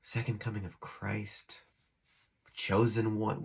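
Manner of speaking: usual speaking voice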